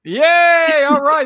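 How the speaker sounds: high, loud cheer; excited. Real, not acted. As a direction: cheer for the game. Game starting cheer.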